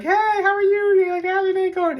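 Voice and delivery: very high, loud cheery greeting; exaggerated friendliness. YouTuber imitating friendly Bostonians greeting strangers, gushing and high. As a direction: gush a hammed-up friendly hello.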